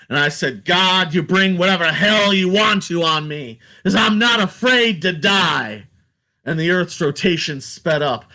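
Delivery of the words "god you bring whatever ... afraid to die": defiant call to the heavens, raised; yelled at the sky. Retold or imitated. Soldier defying God from the battlefield, raised yell. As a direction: throw it up at God. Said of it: "yelled at the sky".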